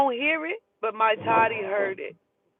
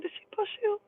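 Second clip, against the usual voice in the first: hushed high question; 11 semitones higher, 7 dB quieter than usual. Retold or imitated. Asking daughter, high hushed.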